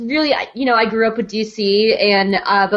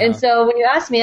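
normal talking voice

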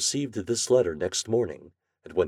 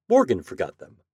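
ordinary delivery, nothing marked

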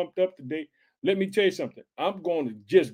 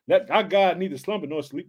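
ordinary talk

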